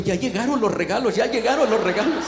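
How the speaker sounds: lightly excited, quickening repetition; happy children. Retold or imitated. Children spotting the gifts have arrived, happy children. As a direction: imitate children's glad chatter. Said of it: "los niños estaban felices".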